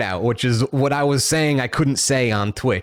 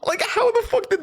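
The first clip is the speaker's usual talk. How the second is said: high, laughing through the words; real laughter breaking into the words; wheezy laughing retelling; laughing hard; 8 semitones higher than usual. Real, not acted. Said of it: "I was laughing so fucking hard".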